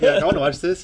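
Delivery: giggling mock eagerness; laughing. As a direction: laugh through the words while mocking. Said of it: "So you're like".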